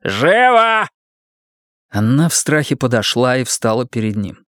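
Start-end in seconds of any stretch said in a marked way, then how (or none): 0.0-0.9 high, furious snap; shouted order. A narrator's reading. Husband hurrying wife over for punishment, shouting. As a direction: bellow it as a command.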